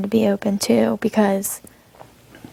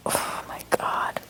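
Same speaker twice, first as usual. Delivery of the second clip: slow, whispered exclamation; hushed aside; 10 dB quieter, slower than usual. Real, not acted. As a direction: let it out slowly on a breath, whispering. Private exasperated aside to camera.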